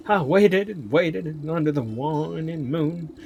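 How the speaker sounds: high, loud, forlorn Southern maiden; drawn-out melodramatic Southern lament; Southern accent. Acted. Maiden mourning her absent lover, high, loud Southern accent. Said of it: "giving her a southern accent".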